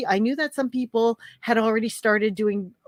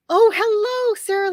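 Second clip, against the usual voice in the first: excited greeting; 12 semitones higher, 6 dB louder than usual. Real, not acted. Greets a new viewer.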